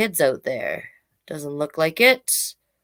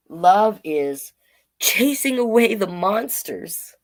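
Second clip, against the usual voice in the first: put-on reading to children; 4 dB louder than usual. Reads picture book to children.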